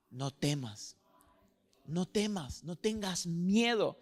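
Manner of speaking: "No temas" low and soft, falling gently; hushed comfort. Shown as a demonstration. Pastor comforting listeners, hushed and gentle. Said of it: "Dios te quiere decir eso a ti".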